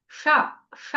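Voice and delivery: ష from the టా position, tongue cupped; ష with the tongue curled back; correct sha demo. Shown as a demonstration. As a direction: model ష slowly for the class. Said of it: "ఈ విధంగా పలకాలి".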